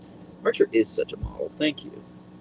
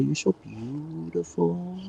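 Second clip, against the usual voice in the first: higher, slow and softer coo; soft pet talk; 7 semitones higher, 5 dB quieter, much slower than usual. Real, not acted. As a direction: croon it slowly to the dog. Cooing to her dog.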